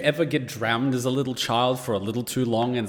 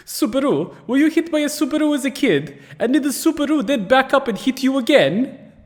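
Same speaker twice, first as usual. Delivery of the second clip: much higher and louder, near a shriek; delighted yell; 12 semitones higher, 5 dB louder than usual. Shown as a demonstration. Repeating the best viewer joke at the screen, in a high delighted yell. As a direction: belt the joke out in gleeful disbelief. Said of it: "That is the best one".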